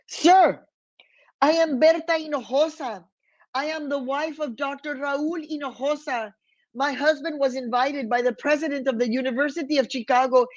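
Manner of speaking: high, fast, pleading yet firm mother; a small woman standing up to the agent, her voice rising; mother speaking up to the immigration agent, high and urgent; defiant mother. Retold or imitated. Mother confronts agent for her baby.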